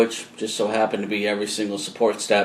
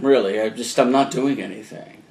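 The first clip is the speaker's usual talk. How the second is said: usual speaking voice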